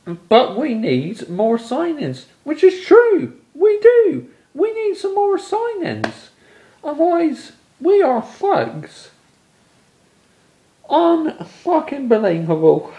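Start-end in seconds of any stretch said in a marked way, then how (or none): 2.4-12.9 furious outburst. Real, not acted. Fan ranting at his club's signings, shouting furiously. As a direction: blow up at the club, voice cracking high. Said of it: "I'm so pissed off".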